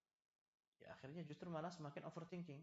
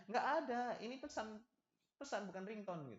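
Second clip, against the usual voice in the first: high, loud protest easing off; acted exasperated denial; mock exasperated husband; 8 semitones higher, 6 dB louder than usual. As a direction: protest innocence in a play quarrel. Acted husband protests against accusation.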